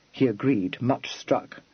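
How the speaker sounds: plain reading voice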